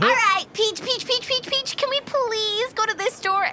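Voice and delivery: very high begging whine; pleading kid. Acted. Pearl begging Peach to go into the store, high whine. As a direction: nag Peach repeatedly. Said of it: "begging Peach".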